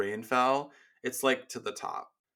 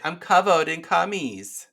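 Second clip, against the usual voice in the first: higher and louder, leaning on covered; emphatic cutesy delivery; 6 semitones higher, 7 dB louder than usual. Shown as a demonstration. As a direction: say it with cutesy relish. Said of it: "you have to say it like this".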